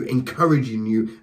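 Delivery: normal talking voice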